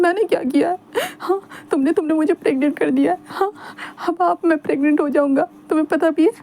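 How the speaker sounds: hurt and theatrical, pressing him; Wing accusing. A narrator's reading.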